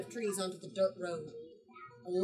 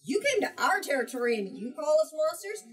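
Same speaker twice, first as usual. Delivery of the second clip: louder, higher, indignant retort; indignant Jumbies; 7 semitones higher, 7 dB louder than usual. Retold or imitated. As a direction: speak as the wronged spirits, offended. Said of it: "To the Jumbies, it's".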